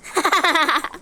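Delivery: rapid staccato pretend cackle; laughing. Child having fun in the game, staccato laugh. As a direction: laugh in quick even bursts.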